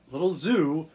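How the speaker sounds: normal talking voice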